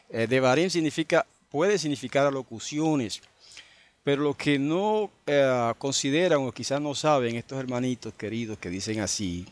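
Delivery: ordinary delivery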